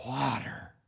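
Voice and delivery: soft, low, dry murmur of a thirsty man; parched murmur. Acted. Thirsty wanderers in the desert murmuring, soft and low. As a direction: say it like the throat is dry.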